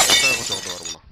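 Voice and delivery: father refusing to spend a taka, yelling; angry yell. Acted. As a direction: bark it out, no money at all.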